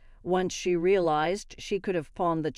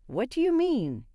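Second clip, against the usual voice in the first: confused; 7 semitones higher than usual. A narrator's reading.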